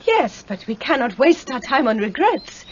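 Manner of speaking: ordinary talk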